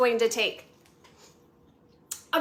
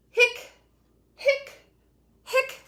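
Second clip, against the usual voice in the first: hiccuping; 12 semitones higher, much slower than usual. Acted. Voicing skeleton's hiccups.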